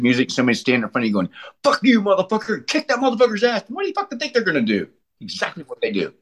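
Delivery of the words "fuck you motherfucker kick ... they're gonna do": aggressive heckler. Acted. Acts out aggressive crowd egging on.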